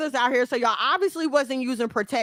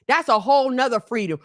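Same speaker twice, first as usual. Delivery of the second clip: usual speaking voice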